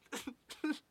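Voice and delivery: high, incredulous laugh; a sudden high laugh of disbelief; burst of laughter. Real, not acted. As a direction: laugh out at the absurd question. Laughs at the question.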